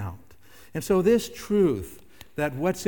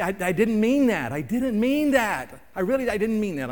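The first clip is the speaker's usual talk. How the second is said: higher, louder acted pleading; insistent protest; 7 semitones higher, 5 dB louder than usual. Person excusing words that slipped out, insistent acted protest. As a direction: plead your excuse over and over.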